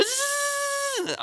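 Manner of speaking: very high, slow buzzing zzz; firework buzz. Retold or imitated. Describing ground blooms to the audience, high firework buzz.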